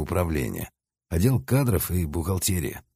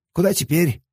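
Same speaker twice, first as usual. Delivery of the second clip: put-on eager young lad; 11 semitones higher, 4 dB louder than usual. A narrator's reading. Young assistant asks.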